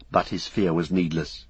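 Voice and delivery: plain reading voice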